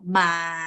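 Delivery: drawn-out "ma" straight and low. Shown as a demonstration. Teacher showing it stays straight, low level "ma". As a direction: hold "ma" low without movement. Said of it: "which is straight".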